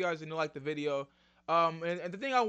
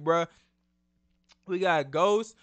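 ordinary talk, nothing marked

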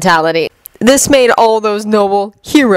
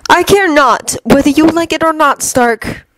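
firm Loki voice, higher, level; quiet but firm; 9 semitones higher than usual. Acted. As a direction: set the rule down firmly. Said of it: "in a quiet but firm tone".

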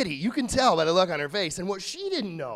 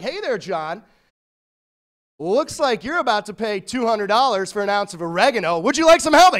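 chirpy helper swelling loud on the offer; bright assistant voice, loud rising "help"; Clippy voice; 2 semitones higher, 9 dB louder than usual. Acted. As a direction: play the eager paperclip helper. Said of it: "be like".

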